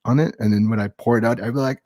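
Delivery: ordinary talk, nothing marked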